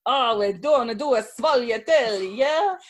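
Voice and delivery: mock Italian baker accent; lightly voiced Italian accent. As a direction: ham up an Italian pastry chef accent. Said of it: "The queen of accenting".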